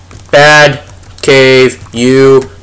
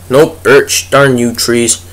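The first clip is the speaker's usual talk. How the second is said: normal talking voice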